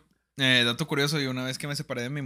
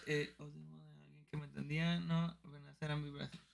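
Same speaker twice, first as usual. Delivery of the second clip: soft drawn-out mock read; 16 dB quieter than usual. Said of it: "Pero dice".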